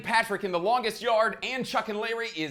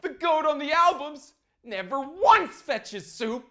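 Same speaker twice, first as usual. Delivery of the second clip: exasperated rant; 9 semitones higher, 5 dB louder than usual. Real, not acted. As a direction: lecture annoyed commenters, building louder.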